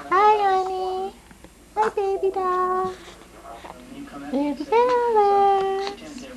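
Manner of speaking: high, slow soothing coo. Real, not acted. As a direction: coo up high to calm her. Cooing at crying baby.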